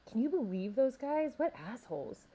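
mildly angry, starting high; indignant complaint, no shouting. A narrator's reading. Joshua complains about classmates at his locker, indignantly angry. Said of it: "Joshua said angrily".

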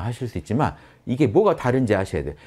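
ordinary delivery

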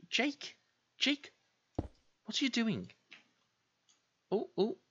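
squeaky, incredulous questioning; high-pitched self-scolding. Acted. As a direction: question yourself in a small high voice.

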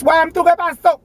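imitating a guy calling her name; lightly voiced street caller; imitating a passerby. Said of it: "para que la gente que".

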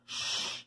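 soft, hissed "shh"; whispered shush. A narrator's reading. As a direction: hush someone with a quiet shush. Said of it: "she whispered".

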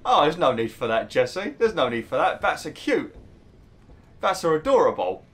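high, doting gush over bats. Acted. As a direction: coo as if defending a pet. Gushing about bats to game character.

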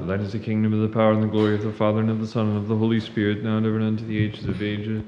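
a priest's full-voiced ecphonesis; booming liturgical doxology; loud priestly exclamation. Real, not acted.

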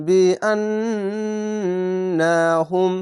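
বিআন্নাহুম chanted slowly with the ন্ন held through the nose; nasal ghunnah. Shown as a demonstration. Teacher chants the ghunnah for pupils, slowly. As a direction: hum the নুন in বিআন্নাহুম long. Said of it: "এখানে ওয়াজিব গুন্না".

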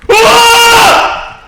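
loud high scream of fright; startled scream. Real, not acted. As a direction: shriek at the jump scare. Sudden jump scare. (깜짝 놀란 비명)

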